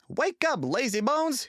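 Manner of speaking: high, loud cheeky taunt; small tank engine jeering in a high loud voice; cheeky little engine. A narrator's reading. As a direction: tease a sleepy big engine, high and loud. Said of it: "he whistled".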